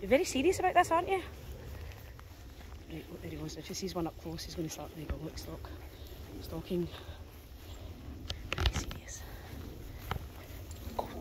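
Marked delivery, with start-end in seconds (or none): 2.9-9.2 softly urging to watch, barely voiced; hushed whisper. Real, not acted. To viewers, watching the dog stalk, a soft whisper. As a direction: keep it hushed so the dog stays focused.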